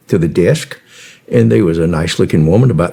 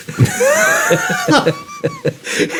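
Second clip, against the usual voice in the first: a high wheezing laugh; amused high cackle. Real, not acted. Laughs at his own line.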